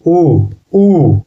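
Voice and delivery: first उ shorter, second उ held; two separate "oo" sounds on उ, the latter stretched; vowel-length demonstration on उ, उ; short then long उ. Shown as a demonstration. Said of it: "थोड़ा जोर लगता है बोलने में".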